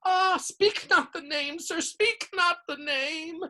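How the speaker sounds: high, slow fearful elf moan; terrified house elf moaning. A narrator's reading. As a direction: beg him not to say it. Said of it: "and moaned".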